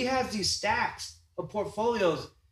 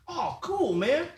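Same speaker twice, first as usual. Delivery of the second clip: much higher, eager and cocky; imitating his younger self; 8 semitones higher than usual. Teller imitating himself meeting a young artist, high and eager. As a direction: bounce up high, sure he's met an equal. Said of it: "I was like".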